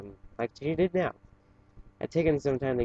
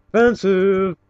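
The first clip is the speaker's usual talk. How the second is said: mock offense; 7 semitones higher, 11 dB louder, slower than usual. Mock outrage at unicorn smell line.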